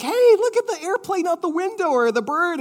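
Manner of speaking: put-on high, chirpy voice to distract a crying child; high sing-song parent distracting a toddler; bright distracting parent.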